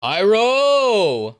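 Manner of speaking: high, loud cheering call; delighted shout. Real, not acted. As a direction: hail a favourite character at full voice. Hails a favourite character.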